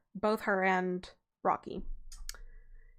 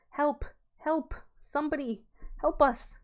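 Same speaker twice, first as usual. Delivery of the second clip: high, desperate cries of help; a hint of a terrified girl screaming; 6 semitones higher than usual. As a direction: call out in panic, pitched up. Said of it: "Molly screamed louder than she had ever screamed".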